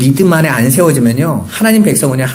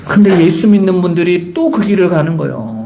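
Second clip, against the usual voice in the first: usual speaking voice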